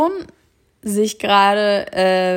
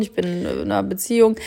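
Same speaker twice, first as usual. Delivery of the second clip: ordinary delivery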